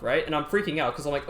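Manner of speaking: ordinary talk, nothing marked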